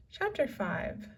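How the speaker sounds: ordinary delivery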